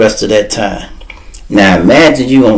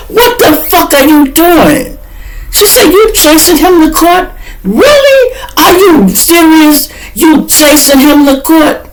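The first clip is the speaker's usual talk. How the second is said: scolding lawyer. Retold or imitated. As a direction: tear into them like an outraged lawyer.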